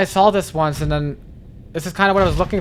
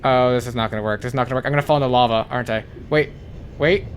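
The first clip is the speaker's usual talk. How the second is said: ordinary talk, nothing marked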